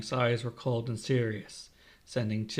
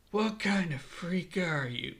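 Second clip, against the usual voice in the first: high, slow, appalled question; mild shock, pitch lifted; horrified gasp; 6 semitones higher, slower than usual. A narrator's reading. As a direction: gasp it out in disbelief. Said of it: "Higuma gasps".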